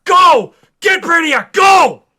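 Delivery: high, loud urgent shouts; desperate shouted orders; screaming. Retold or imitated. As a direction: yell at them to run while in pain. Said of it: "Superman just screaming".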